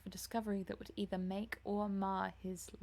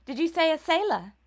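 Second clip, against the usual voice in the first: alarmed; 7 semitones higher, 13 dB louder than usual. A narrator's reading. Dorian alarmed by news.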